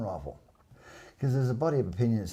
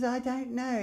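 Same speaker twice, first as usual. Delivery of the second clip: imitating him in a much higher, slow childlike voice; killer's voice; 14 semitones higher, slower than usual. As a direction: mimic a meek man-child greeting. Witness imitates killer's answer.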